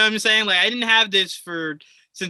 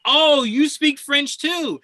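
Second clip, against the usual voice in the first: higher, slow, mock delighted gush; 8 semitones higher, 4 dB louder, much slower than usual. Host imitating an excited stranger who spots a fellow speaker. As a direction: gush as if you found a fellow French speaker.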